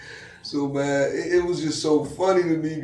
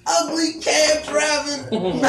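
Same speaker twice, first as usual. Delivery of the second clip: mock insult; 12 semitones higher, 3 dB louder, much slower than usual. Friend insults cab driver.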